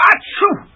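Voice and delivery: sharp high sneeze. Acted. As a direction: let the sneeze burst out. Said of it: "between paroxysms".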